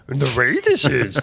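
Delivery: playful, drawn-out impression with a laugh; Yoda. Chaplain joking with co-hosts, slow playful impression of a little sage. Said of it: "That's a pretty good Yoda voice".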